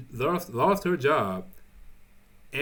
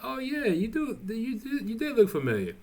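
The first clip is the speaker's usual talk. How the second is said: very high, put-on voice of a dawning realization; oh yeah recognition; 12 semitones higher than usual. Townsperson realizing it's Trevor, in a very high put-on voice. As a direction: go way up, a voiced figure realizing who it is.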